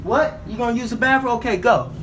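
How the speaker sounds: calling out. Real, not acted. Yelling at sister indoors.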